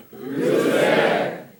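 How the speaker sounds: normal talking voice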